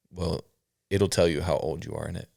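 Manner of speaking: ordinary delivery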